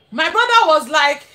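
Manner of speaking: usual speaking voice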